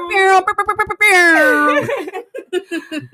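loud, high trilled "brrr" noise; mouthed sound effect. Pretend. Host stands in for missing sound effect, loud trilled noise. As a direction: imitate a horn blast with rolled lips.

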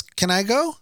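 put-on high-pitched pleading little boy; Mikey. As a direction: imitate a child asking permission. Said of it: "He goes".

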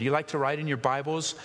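usual speaking voice

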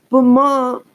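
high, loud whine; whining. A narrator's reading. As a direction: whine it like a sulky kid. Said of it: "Lindsay whined unhappily".

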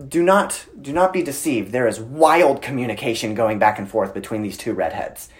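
usual speaking voice

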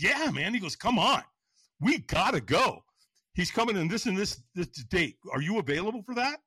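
high, loud retold coaxing; excited urging. Buddy urges friend to see the seminar, excited. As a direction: imitate a buddy pushing you to come.

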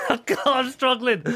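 high, loud, laughing protest; helpless laughter. Real, not acted. Performer failing his own impression, high and laughing. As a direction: struggle to speak through real laughter.

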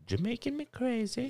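high, hammed-up Jamaican lilt; sing-song Jamaican slogan voice; 1 mock Jamaican. Friend quoting the coffee slogan in a mock Jamaican accent. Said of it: "that's what they always do".

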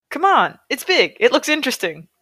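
bouncing with excitement as you urge her up; excited roommate. A narrator's reading. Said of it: "hops from foot to foot excitedly".

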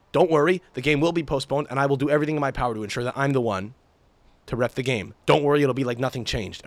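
normal talking voice

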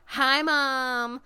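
light high call of hi mom; a hint of an athlete greeting his mom; mock wave to mom. Wrestler greets mom on camera, lightly voiced high call. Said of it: "he literally says that".

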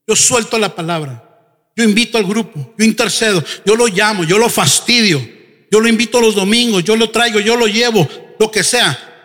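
ordinary delivery

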